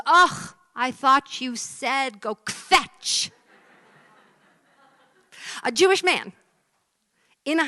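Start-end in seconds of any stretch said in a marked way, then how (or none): 0.0-3.3 loud, drawn-out kvetching groan; hammed-up aggrieved punchline; exasperated complaint. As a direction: groan it out slowly, higher and louder. Dog's exasperated punchline in a joke.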